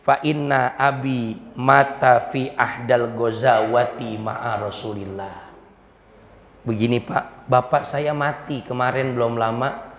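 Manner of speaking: low, soft, grieving account; sorrowful confiding. Acted. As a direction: confide a father's death softly and slowly. Orphan tells of father's death. (bertutur sedih, pelan dan rendah)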